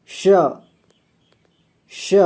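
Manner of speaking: श said twice with a hushing sh; teacher models श slowly as sh; sh sound. Shown as a demonstration. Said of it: "जैसे इसे पढ़ना है".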